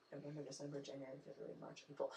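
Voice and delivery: counting months in a whisper. Real, not acted. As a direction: breathe the months under your breath. Counting months under her breath.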